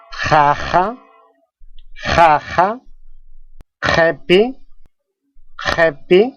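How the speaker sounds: "jaja, jepi, jopa, pojo" with a throat-scraped j; teacher modelling "jaja, jepi, jopa, pojo" with strong guttural j. Shown as a demonstration. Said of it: "se pronuncia como si uno estuviera quedándose".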